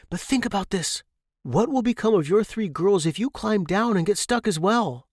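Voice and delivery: Jeremy gently persuading the mother dragon. Acted. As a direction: coax her to think it through.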